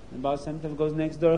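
plain reading voice

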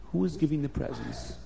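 ordinary delivery

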